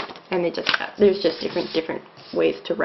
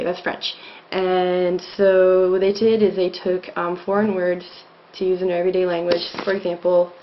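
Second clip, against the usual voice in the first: normal talking voice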